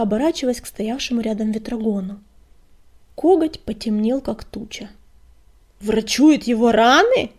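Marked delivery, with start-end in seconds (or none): 5.8-7.3 slow, loud, incredulous roar. A narrator's reading. Deputy echoes the news in disbelief, roaring. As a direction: roar the question back, drawing it out. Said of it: "проревел он".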